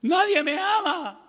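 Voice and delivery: high, slow sulky whimper; self-pitying whine. Retold or imitated. Angry believer sulking in self-pity, high slow whine.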